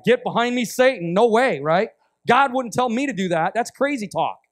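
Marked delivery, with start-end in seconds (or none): 0.0-1.6 retold loud rebuke of Satan; a half-played loud refusal; mock rebuke. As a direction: push the temptation away, louder. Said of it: "And I'm like".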